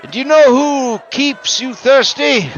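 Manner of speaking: high, loud rallying question; rabble-rousing orator. Acted. Sylph orator rousing the protest crowd, high and loud. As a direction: whip the crowd up. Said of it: "he's like".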